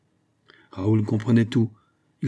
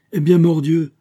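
high, loud oath; duke's forceful outburst; exclaiming; 8 semitones higher, 8 dB louder than usual. A narrator's reading. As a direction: burst out with an oath. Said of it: "s'écria le duc".

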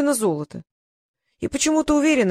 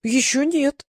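higher, puzzled answer; surprised reply; 5 semitones higher, slower than usual. A narrator's reading. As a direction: answer as if caught off guard. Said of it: "удивлённо ответил Мумрик". (удивлённый ответ)

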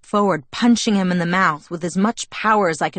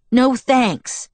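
higher, curt angry refusal; hissed; 6 semitones higher, 4 dB louder than usual. A narrator's reading. Bella turning down Jacob's ride, hissing. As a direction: refuse with a touch of venom. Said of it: "I hissed".

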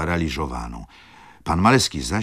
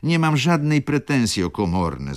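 high, raised-voice outburst from the baroness; desperate exclamation; 10 semitones higher, 4 dB louder than usual. A narrator's reading. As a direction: cry out pleadingly, pitched well up. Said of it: "zawołała baronowa". (wysokie, błagalne wołanie baronowej)

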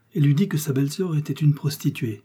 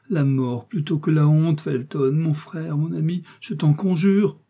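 higher, imploring plea; veiled-voice entreaty; 5 semitones higher, 3 dB louder than usual. A narrator's reading. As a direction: beg with mounting urgency. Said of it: "en voilant sa voix et ses paupières".

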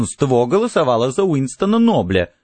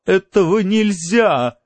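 high, drawn-out whine; slow, pitched-up lament; plaintive wail; 7 semitones higher, slower than usual. A narrator's reading. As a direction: wail the protest, stretching the last word. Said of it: "жалобно возопила миссис Бауэллс".

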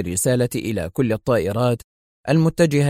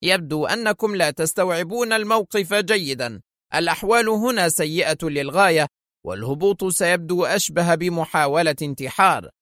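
growing irritation; 6 semitones higher than usual. A narrator's reading. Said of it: "تضاعفت عصبية مدير البرج".

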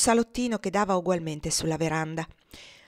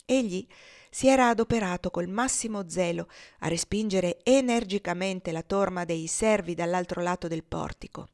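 usual speaking voice

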